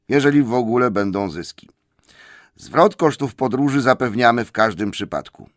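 pompous business letter, projected; formal letter reading. A narrator's reading. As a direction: read it out like an official notice. Reads the dwarves' formal letter aloud.

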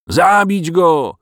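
loud, slow, high mob cry; crowd shouting. A narrator's reading. Villagers losing patience with the dragon, a loud slow shout. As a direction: roar for his death. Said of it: "krzyknęli ludzie".

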